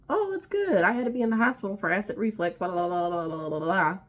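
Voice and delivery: ex-partner voiced as chirpy and careless; mocking her voice. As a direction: parody her breezy, dismissive update. Mimicking her breezy phone reply.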